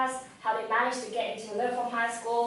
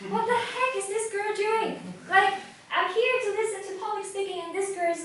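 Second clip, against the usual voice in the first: high-pitched, exasperated audience voice; incredulous mock grumbling; baffled complaint; 8 semitones higher than usual. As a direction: mimic an indignant listener in a higher pitch. Imagined audience baffled by speaker.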